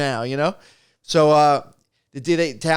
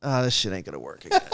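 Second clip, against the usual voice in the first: defeated mutter; 9 semitones lower, 5 dB quieter, faster than usual. Retold or imitated.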